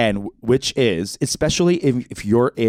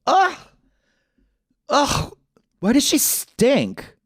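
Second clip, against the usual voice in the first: high, slow, grossed-out whine; disgusted friend; slower than usual. Retold or imitated. Friend smelling the dog, high disgusted whine. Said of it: "she smells it and goes".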